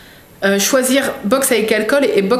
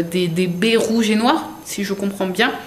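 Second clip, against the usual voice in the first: ordinary talk, nothing marked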